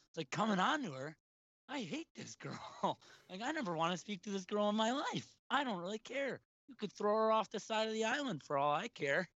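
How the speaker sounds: high, fed-up venting; exasperated rant. Retold or imitated. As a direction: pitch it up like you can't believe this. Ranting about the girl he hates.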